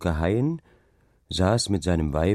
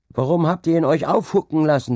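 loud, high-pitched reproach; heckling; 7 semitones higher, 5 dB louder than usual. A narrator's reading. Villager blaming the others at the tavern, heckling. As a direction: challenge them with raised voice and pitch. Tavern quarrel over the dike-master.

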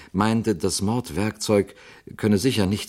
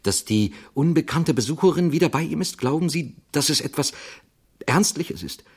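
much higher-pitched, anxious questioning; rising fear; 10 semitones higher than usual. A narrator's reading. Jarvis fears the visitor is back with Manville, anxious. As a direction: voice climbs as alarm grows, halting before the worst. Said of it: "in dessen Augen Angst aufstieg".

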